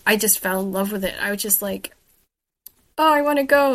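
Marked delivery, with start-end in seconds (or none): 3.0-3.8 higher, louder burst of wanting; an eager, retold "oh" moment; eager longing. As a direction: quote your own eager reaction. Retelling her own eager reaction.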